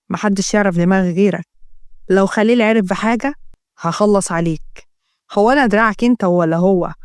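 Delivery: low, guarded male voices; two scheming men. Acted. (صوت رجلين يتآمران، منخفض الطبقة)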